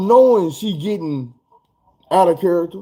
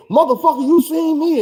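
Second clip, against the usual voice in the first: high-pitched mock cussing; hammed-up angry girlfriend; imitating her going off; 11 semitones higher than usual. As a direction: play her snapping at the man.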